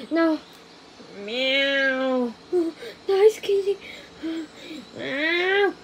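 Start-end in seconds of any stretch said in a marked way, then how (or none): 1.2-2.3 soft, lower cat meow. Pretend. Player meowing to lure the kitty, soft meow. 5.0-5.7 soft meow with a laugh in it. Pretend. Player meowing at the kitty, laughing meow.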